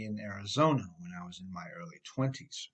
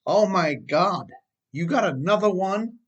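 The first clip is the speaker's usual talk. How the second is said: higher, louder shocked outburst; wife's blurt; 7 semitones higher, 7 dB louder than usual. Retold or imitated. Wife finding another speeding ticket, shocked loud outburst. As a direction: blurt it out in disbelief. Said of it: "blurt out".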